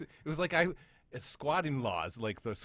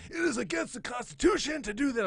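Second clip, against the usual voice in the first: high-pitched mocking whine; hammed-up constitutional outrage; 11 semitones higher, 3 dB louder than usual. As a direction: parrot an indignant know-it-all in a high voice. Mocking someone's outraged online comment.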